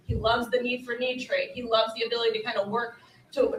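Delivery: ordinary delivery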